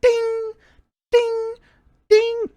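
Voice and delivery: high ringing ding imitations; hammer dings. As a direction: ring out like a hammer on an anvil.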